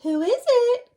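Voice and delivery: put-on high, loud old granny call; granny calling through the door. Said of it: "called Granny".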